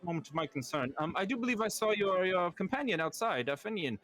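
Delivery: high, showy lord calming his party guests; pompous host. Acted. Lord hushing his party guests, pompous and high. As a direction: boom it out like a vain birthday host.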